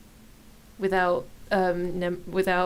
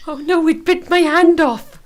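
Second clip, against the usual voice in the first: loud, high fake alarm; mock panic; 7 semitones higher, 11 dB louder than usual. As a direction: cry out in hammy terror. Mock panic over bitten hand.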